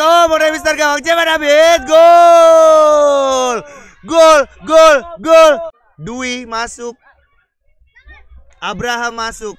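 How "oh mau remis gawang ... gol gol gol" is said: higher, loud goal shouting; goal call. Real, not acted. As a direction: scream the goal. Commentator calls a goal. (teriakan gol)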